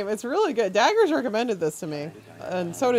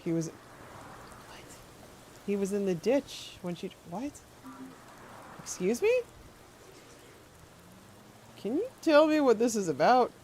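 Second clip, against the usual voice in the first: ordinary delivery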